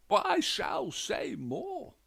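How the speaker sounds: high, loud and slow; insistent gentleman. A narrator's reading. Widower insists to the detectives, high, loud, slow. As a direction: push on with the grievance, slow and loud.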